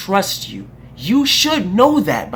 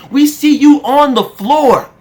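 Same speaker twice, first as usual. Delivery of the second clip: angry shout; 6 semitones higher, 7 dB louder, slower than usual. Real, not acted. Angry rant against politician.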